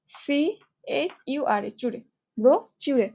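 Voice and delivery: normal talking voice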